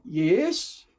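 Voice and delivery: loud, high, tripping over the yes; can barely contain it; stammering keen acceptance; eager yes. Retold or imitated. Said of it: "And I went".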